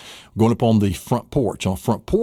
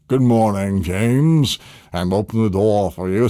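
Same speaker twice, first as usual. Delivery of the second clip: lightly voiced doorman; 2 semitones lower than usual. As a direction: greet like a courteous snowman doorman. Snowman doorman greets you.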